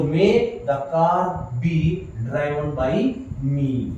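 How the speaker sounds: slow, clear model answer; slow dictation. Shown as a demonstration. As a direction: read the answer out for them to copy. Teacher reads final answer slowly. (धीमे, साफ़ उत्तर बोलते हुए)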